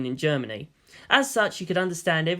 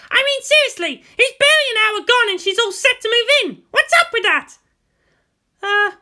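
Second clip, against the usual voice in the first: high, loud voiced women arguing; female voices argue; 13 semitones higher, 6 dB louder than usual. Guardian and girl argue over the commander, heated female voices. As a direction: play both women hot-tempered.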